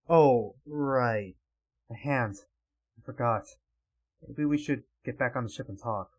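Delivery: low, soft, halting character voice; lightly voiced, sheepish and deflated; subdued Trace. Trace remembering the hand, subdued and low.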